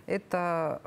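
usual speaking voice

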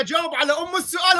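loud demanding yell at the opponent; heated shout. Real, not acted. Debater shouting at the cleric to answer the question. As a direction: shout the demand across the table.

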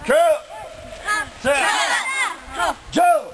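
shouted dojo commands; shouted drill count. Real, not acted. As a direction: call the count like a sensei.

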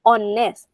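honest starting straight on the O, H dropped; silent H. Shown as a demonstration. Teacher showing students a vowel-sound word, honest with silent H. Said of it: "ไม่ต้องออกเสียงตัว H".

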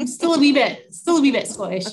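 put-on light, playful Scottish accent. Guest joking with hosts who asked for it, light Scottish accent. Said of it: "seminar with a Scottish accent".

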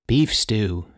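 plain reading voice

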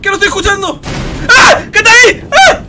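high, loud cries of alarm; panicked shouting. Real, not acted. Streamer hearing the monster come, terrified yelling. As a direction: yell in real fright as it closes in.

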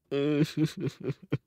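high chuckling run of laughter; laughing. Real, not acted. Player suspecting they're all teaming, laughing.

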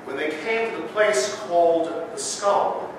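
measured, solemn Gospel reading; scripture reading. Acted. Reads Gospel in service.

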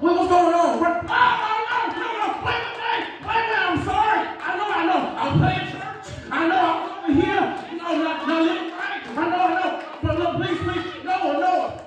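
high, frantic begging; panicked pleading. Acted. Someone locked out of the ark, high frantic pleading. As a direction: beg to be let back in.

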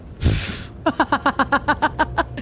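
high, long staccato laugh. Real, not acted. Streamer saw the impalement coming, high uncontrolled laughter. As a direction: burst into a run of high ha-ha-has. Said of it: "Sorry for laughing".

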